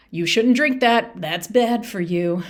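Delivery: mock scolding about drinking; preachy lecturing. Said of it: "I definitely went down the road of".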